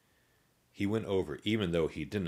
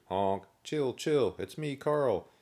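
higher, looping zombie catchphrase; higher singsong zombie voice; repeating zombie; 8 semitones higher, 5 dB louder, slower than usual. A narrator's reading. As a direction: say it like a broken record. Carl repeats his catchphrase again.

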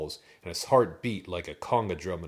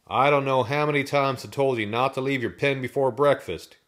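raised, higher exasperated voice; loud fed-up farmer; farmer scolding; 6 semitones higher, 8 dB louder than usual. A narrator's reading. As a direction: tell off disobedient birds loudly. Farmer scolds his ostriches.

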